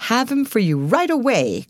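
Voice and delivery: high, eager-to-oblige call; calling back. A narrator's reading. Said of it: "called the property man".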